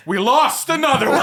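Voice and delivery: high, loud mock-outraged wail; mock anger. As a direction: imitate a friend wailing over a lost cause. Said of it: "Brian got so mad on the phone".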